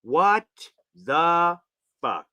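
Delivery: word by word in disbelief; spaced out, each word its own beat; slow stunned what the fuck. Real, not acted.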